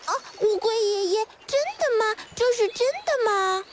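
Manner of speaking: high-pitched eager little voice; excited tadpoles. Acted. Tadpoles questioning grandpa turtle, excited and high. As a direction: ask in thrilled disbelief. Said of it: "激动地忘记了哭".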